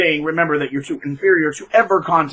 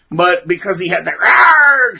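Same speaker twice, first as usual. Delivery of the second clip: mock frustrated growl; 5 dB louder, slower than usual. Mocking his self-loathing.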